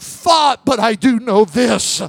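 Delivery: slow, shouted revival sermon; full-force preaching. Acted. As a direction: preach it to the whole church.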